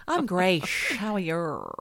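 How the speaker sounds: "grey" running on into a hissed "shh"; mock "I'm grey, shh" with a shower-like hiss; hissing word ending. Shown as a demonstration. Co-host mimicking an exaggerated greeting, "grey" with hissed ending. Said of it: "like the shower's just been turned on".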